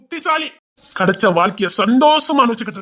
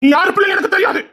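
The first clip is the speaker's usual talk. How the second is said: furious shout; 9 semitones higher than usual. Acted. Father shouts his rejection at the pleading daughter, furious. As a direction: hurl the words at her.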